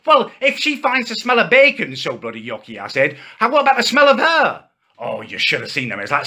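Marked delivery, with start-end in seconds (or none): 0.1-2.8 high, sarcastic jab at the vegans; retold comeback, pitched up and cheeky; mocking retort. Telling the vegans off with a sneering jab. 3.4-4.6 much higher, louder sarcastic jab; cheeky punchline. Retold or imitated. Firing the punchline back at the vegan coworker.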